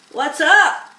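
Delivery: loud, high, abrupt rat voice; curt rat. A narrator's reading. Rat Templeton asking the barn animals, curtly. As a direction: bark it out as you enter.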